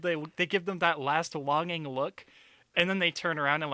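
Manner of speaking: ordinary talk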